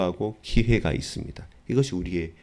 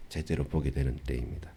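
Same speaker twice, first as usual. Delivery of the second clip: soft echo; 7 semitones lower, 8 dB quieter than usual. Acted. Softer lower echo.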